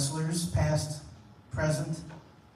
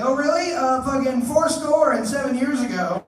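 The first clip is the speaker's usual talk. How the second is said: mock Amelia reply; 7 semitones higher, 7 dB louder than usual.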